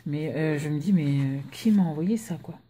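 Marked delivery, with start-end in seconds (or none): none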